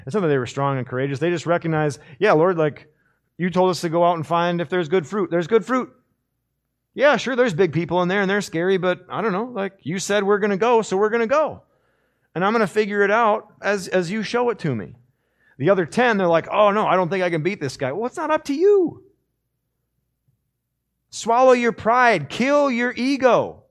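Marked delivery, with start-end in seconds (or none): 2.2-15.0 high, loud, bright-eyed trust; a brave young believer saying let's go; eager Caleb. Acted. Caleb telling the Lord he will go, eager and high. 16.5-17.9 hammed-up panicked whine, fast; cowering scouts losing their nerve; mock fearful spies. The ten spies backing away from the giants, mock scared. 17.9-23.6 much higher, loud and slow commands; ringing rebuke. Acted. Preacher rebuking the fearful spies, loud and slow.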